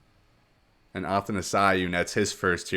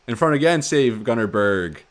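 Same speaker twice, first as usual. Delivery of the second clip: louder, urgent play-by-play; excited save call; 4 semitones higher, 7 dB louder than usual. Real, not acted. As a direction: burst out as the chance is saved.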